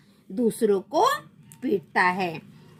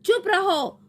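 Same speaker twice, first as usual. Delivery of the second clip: high-pitched cross demand; angry; 9 semitones higher than usual. A narrator's reading. Raat Rani hushing the crying children, angry. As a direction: scold them in a raised pitch. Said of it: "गुस्से में बोल रही है".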